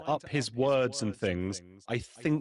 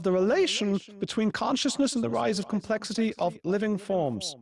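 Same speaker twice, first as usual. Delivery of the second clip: much higher, nasal-free but strained pedantic lecturing; Jordan Peterson impression; 8 semitones higher than usual. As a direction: channel a high, earnest professor rambling. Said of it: "I'm gonna do the accent of the person".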